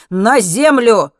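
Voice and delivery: shouted order. A narrator's reading. Alex orders Henry to the ground, shouted command. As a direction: bellow it slowly as an order to drop.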